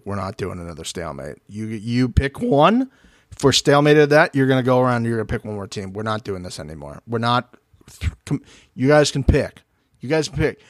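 low, firm mock-official voice; retold producer ruling. Producers putting their foot down on another stalemate, lightly voiced low and firm.

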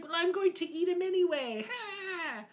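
high witch voice ending in a cackling ha; triumphant witch. Acted. Witch telling the children she'll eat Hansel anyway, high and gloating. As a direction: gloat like a wicked crone.

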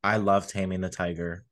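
ordinary talk, nothing marked

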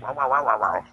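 murmured wow wow imitating a muddy ghost recording; garbled recording mimic. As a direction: copy the mumbling sound of an EVP clip. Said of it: "where you hear, like".

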